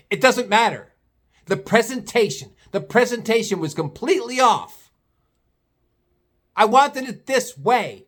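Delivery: mock irate customer, pitched high; high-pitched retold outrage. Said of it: "can you please lower your voice?".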